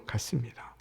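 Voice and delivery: whispered. Real, not acted. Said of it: "찢어진 마음을 안고".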